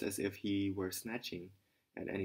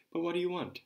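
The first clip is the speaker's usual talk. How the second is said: high, louder angry demand; angry shout; 9 semitones higher, 6 dB louder than usual. A narrator's reading. Caretaker shouting at Raskolnikov, getting angry. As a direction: bark it at the pest. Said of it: "the caretaker shouted again".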